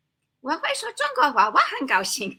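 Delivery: sing-song English tune, tones ignored; swooping English intonation over the Chinese. Shown as a demonstration. Learner demonstrating wrong tones with English intonation. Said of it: "It'll sound like that".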